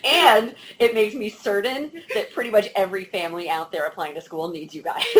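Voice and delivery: laughing. Real, not acted. Host laughing.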